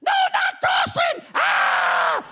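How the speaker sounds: high, loud mock panic screaming; panicked scream. Comic freaking out the whole plane, shrieking loud and high. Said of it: "freak the whole plane out and go".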